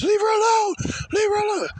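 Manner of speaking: very high, loud squeaky mock yell; mock yelling boyfriend. Boyfriend yelling at police, high squeaky mock yell. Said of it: "started yelling at the police".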